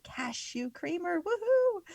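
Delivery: excited whoop at the end. Real, not acted. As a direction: cheer it, pitch leaping up on the last word. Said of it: "Sorry, I'm excited".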